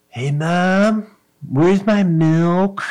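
put-on high, slow, loud little-baby voice. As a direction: play the baby demanding his milk. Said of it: "I do voices for him".

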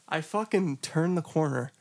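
ordinary talk

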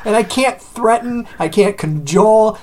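usual speaking voice

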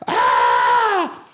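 high hammed-up child's cry; mock kid wail. Retelling the Australian kid crying on the flight, high mock wail. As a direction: wail like a bawling little kid. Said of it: "he crying".

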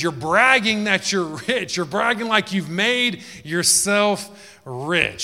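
high, loud, slow accusation; loud scornful rebuke. Acted. Jesus exposing the church's boast, loud and high. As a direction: throw their bragging back at them.

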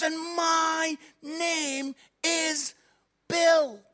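very slow build to the name; dramatic slow pause. Retold or imitated. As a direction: drag it out for the punchline. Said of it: "he goes".

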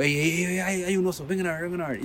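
high, urgent calls to come and see; breathless alarm, waving everyone over; excited calling out. Retold or imitated. Arriving at the campfire, calling the three friends to see the bear, high and urgent.

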